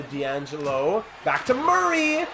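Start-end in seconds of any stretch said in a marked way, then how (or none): 1.2-2.3 excited call. Real, not acted. Sudden pass, call out.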